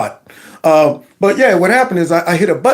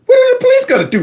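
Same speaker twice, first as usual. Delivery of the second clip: incredulous; faster than usual. Real, not acted. Incredulous question.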